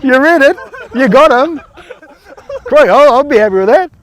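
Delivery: loud, high cheering call across the field; excited praise shout. Real, not acted. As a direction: yell out real praise as he makes the tackle. Coach calling out during drill.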